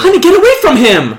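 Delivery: high, quick, urgent warning; sharp rise into maternal alarm; alarmed mother. Acted. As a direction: call the child away in a hurry. Mother warns child away from stranger.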